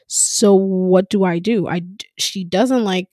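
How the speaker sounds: ordinary delivery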